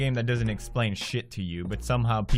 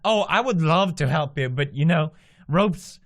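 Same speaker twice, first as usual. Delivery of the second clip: higher, fake-sweet taunting offer; mock helpful; 6 semitones higher, 4 dB louder than usual. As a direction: pretend concern while fleecing them. Taunting trapped players for money.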